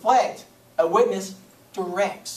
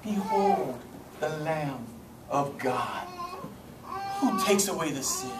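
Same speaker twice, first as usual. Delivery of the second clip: calm, stately reading, softening as it goes; mock-solemn reverence, slow and peaceful; distinguished voice; 3 semitones lower, 8 dB quieter, slower than usual. Preacher parodies how we read calm John, distinguished and soft. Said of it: "in his most distinguished voice".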